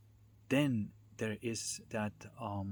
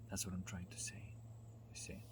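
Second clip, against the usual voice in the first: whispered aside; 2 semitones lower, 11 dB quieter than usual. Real, not acted. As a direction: drop to a whisper for the last words. Aside to listeners, dropped to whisper.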